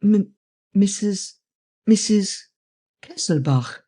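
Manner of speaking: high, halting stammer that drops on the name. A narrator's reading. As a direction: stammer it out in broken pieces. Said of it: "and he stammered".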